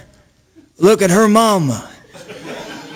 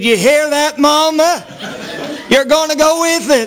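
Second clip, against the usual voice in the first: higher, thrilled wife calling to her mama; excited wife; 6 semitones higher than usual. Retold or imitated. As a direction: squeal it out like a giddy young bride. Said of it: "She got so excited".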